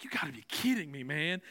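whispered dread; scared whisper at the doctor's needles. Retold or imitated. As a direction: breathe it out in disbelief.

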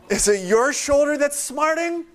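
mock indignant retort. Preacher as hurt Spencer challenging the would-be forgiver, mock indignation. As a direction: toss back an incredulous, high-pitched question. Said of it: "Spencer would be like".

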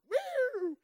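sudden high excited whoop; thrilled cop whooping at a shiny tanker, high whoop; high whoop of delight. Real, not acted. Admiring the truck.

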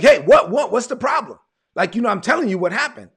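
high, loud retold exasperation; exasperated mother. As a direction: imitate an exasperated mother demanding answers. Mother fed up with repeated questions.

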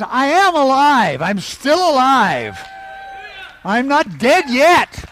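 high and loud, triumphant; loud declaration. Shown as a demonstration. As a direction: shout that you are still alive. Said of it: "speak out and gesture a little bit".

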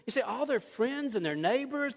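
ordinary talk, nothing marked

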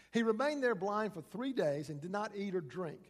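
ordinary delivery

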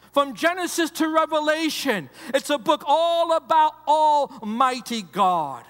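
full-voiced pulpit cry; preaching climax. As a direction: proclaim it to the whole church.

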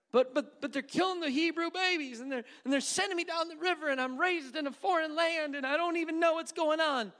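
high, stammering, pleading Moses; panicked Moses. Acted. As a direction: protest to God in a rush of worry.

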